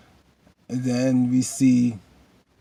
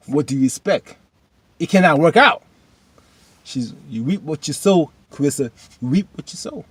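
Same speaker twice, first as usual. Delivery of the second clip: preachy warning; 4 semitones higher, 6 dB louder than usual. Real, not acted. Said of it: "preaching at character".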